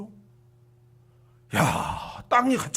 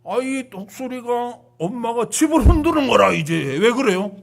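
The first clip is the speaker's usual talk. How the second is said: ordinary delivery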